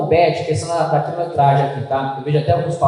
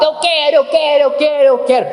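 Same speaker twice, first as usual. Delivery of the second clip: much higher whiny repeated demand; child tantrum; 11 semitones higher than usual. Pretend. Spoiled child demanding dessert from dad, high whiny tantrum. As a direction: play a kid throwing a fit. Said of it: "começa a gritar, começa a chorar alto".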